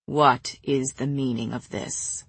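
put-on low, slow older lord's demand; deep, deliberate authority; stern lord. A narrator's reading. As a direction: demand answers in a deep, measured voice. Stern lord confronts the group.

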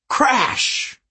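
high, explosive crash; exclaimed crash. A narrator's reading. Narrator voicing the giant's hammer striking, explosive. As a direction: burst it out like a hammer blow.

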